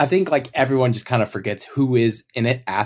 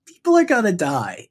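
high mock-celebrity voice; 8 semitones higher than usual. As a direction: imitate the livestream line, pitched well up. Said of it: "She's like".

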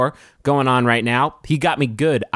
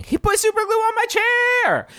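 much higher, pinched wail of outrage; high, pinched aggrieved wail; squeaky distressed poster. Acted. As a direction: squeal it like you can't believe it. Reading stuck poster, squeaky.

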